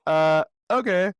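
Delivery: mock hesitant agreement; dubious okay. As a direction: humor them with a slow, skeptical okay. Acted baffled reply to co-parent's demand.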